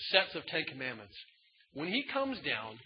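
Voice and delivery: ordinary talk, nothing marked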